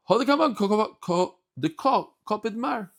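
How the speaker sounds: high, stumbling excited delivery; eager and animated; excited quote. Acted. Quoting the Aramaic retort.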